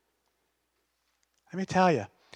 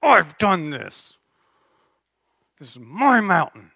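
gritted teeth; 6 dB louder than usual. Acted. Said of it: "he's gritting his teeth".

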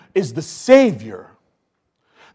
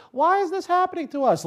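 whiny bullies; 11 semitones higher, 5 dB quieter than usual. Retold or imitated. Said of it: "they're just like".

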